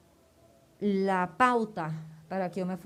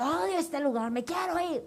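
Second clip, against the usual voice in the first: high, angry retold cry; angry outburst; 9 semitones higher, 3 dB louder than usual. Bursting into her mother's office, high angry outburst.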